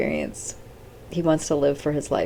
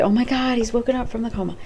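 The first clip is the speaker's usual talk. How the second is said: higher-pitched mock gasp of good news; excited retelling; 7 semitones higher, faster than usual. Relatives hearing he is out of the coma, excited. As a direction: imitate their excitement in a raised pitch. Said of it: "they're all excited".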